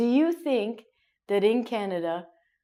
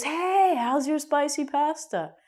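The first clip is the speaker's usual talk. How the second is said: friendly question; 6 semitones higher than usual. Retold or imitated. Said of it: "your friend goes".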